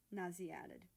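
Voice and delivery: usual speaking voice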